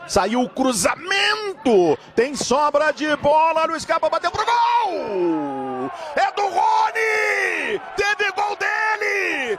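rising pitch, stretched out to a goal scream; goal call build-up. Real, not acted.

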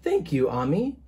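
ordinary delivery, nothing marked

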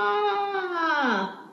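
long, high falling "ah"; scream. Acted. Reader voicing animals getting washed away, hammed-up falling scream. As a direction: draw out a mock wail, sliding down.

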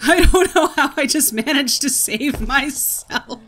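high, loud, drawn-out exclamation; amazed relief. Real, not acted. Player just saved from a fall, loud amazed relief. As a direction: cry out in disbelief at the narrow escape. Narrow escape in the game.

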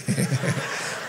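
soft, low laugh; amused chuckle. Real, not acted. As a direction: chuckle softly at your own joke. Preacher laughs at own example.

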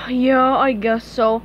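slow, reluctant agreement after a sigh. Acted. Patrick gives in to splitting up, sighing. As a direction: sigh first, then drag the words out. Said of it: "Patrick sighed".

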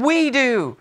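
mock crowd cheer, pitched high; crowd crying out. Salesmen answer their CEO, high mock cry. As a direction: chant back as the sales force. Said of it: "they all cried out in one voice".

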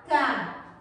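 single out "cá" as the stressed syllable; sílaba tônica isolada. Shown as a demonstration. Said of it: "elas possuem a sílaba mais forte". ("cá" isolado, firme e mais grave)

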